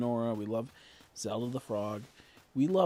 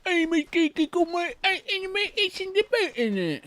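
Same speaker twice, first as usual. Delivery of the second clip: put-on very high, loud squeaky Cockney accent; over-the-top Cockney caricature; 9 dB louder than usual. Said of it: "the Cockney ones".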